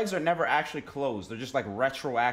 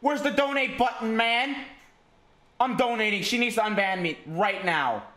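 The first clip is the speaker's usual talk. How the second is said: high, loud exaggerated complaint; hammed-up frantic griping; distressed whining; 9 semitones higher, 5 dB louder than usual. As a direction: whine loudly in mock desperation. Demands to be unbanned.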